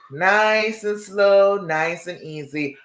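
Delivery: very slow, soothing words; slow and calm. Shown as a demonstration. Coach to viewers, showing slow breathing, slow and calm. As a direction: draw each word out gently. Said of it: "I'm breathing".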